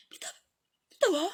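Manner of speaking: crying. Acted. Crying farewell.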